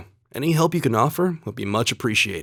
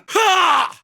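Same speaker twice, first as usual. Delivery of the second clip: sharp high battle yell; high shout as the blow lands; 3 dB louder than usual. Acted. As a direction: strike with a yell. Battle cry in combat.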